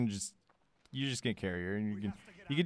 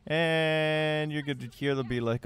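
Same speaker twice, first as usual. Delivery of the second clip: ordinary delivery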